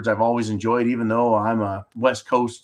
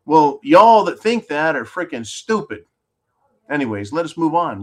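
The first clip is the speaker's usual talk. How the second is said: ordinary delivery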